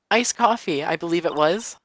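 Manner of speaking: put-on high girlish explaining voice; ditzy witness, self-assured. Witness explaining iced coffee to the lawyer, high and chirpy.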